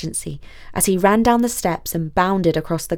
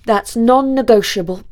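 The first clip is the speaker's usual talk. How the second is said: put-on loud, raised, determined young student; insistent Basil; 4 semitones higher, 7 dB louder than usual. A narrator's reading. Student vows to go with the princess, loud and insistent. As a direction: correct yourself, then insist loudly.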